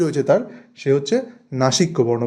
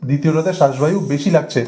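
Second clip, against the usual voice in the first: ordinary talk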